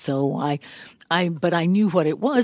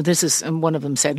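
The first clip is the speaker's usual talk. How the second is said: usual speaking voice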